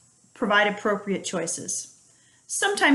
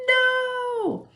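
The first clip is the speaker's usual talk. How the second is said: put-on child's no. Child refusing, quoted.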